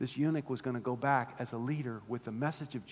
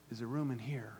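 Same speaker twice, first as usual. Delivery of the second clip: quiet question; 3 semitones lower, 6 dB quieter than usual. Real, not acted. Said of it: "Come on, church".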